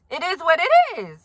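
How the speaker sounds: normal talking voice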